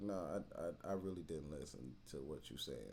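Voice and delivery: low deadpan mumble. Retold or imitated. Young man muttering a casual lie to his worried girlfriend. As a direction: play it down quietly, under the breath. Lying to calm her.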